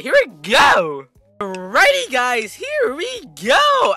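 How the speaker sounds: loud, slow, exaggerated hype; over-the-top YouTuber launch; Adventure Gaming HQ impression. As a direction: stretch out the catchphrase high and loud. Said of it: "I don't sound like him at all".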